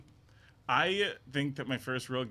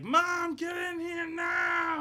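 put-on much higher, slow demanding baby shout; much slower than usual. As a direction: yell for Mom from the crib. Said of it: "and be like".

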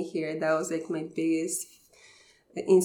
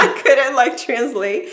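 laughing; 6 semitones higher, 11 dB louder than usual. Real, not acted. Laughing while telling story.

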